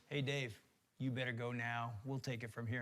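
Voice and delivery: low, quiet, restrained coach; prim military coach. Retold or imitated. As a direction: lightly voice a proper head coach, low and soft. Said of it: "like, this military guy, prim and proper".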